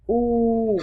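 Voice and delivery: "U" held long and steady. Shown as a demonstration. Teacher closing the lesson, "U" held long. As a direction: stretch "U" out as a long vowel. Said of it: "dài trong tiếng Anh".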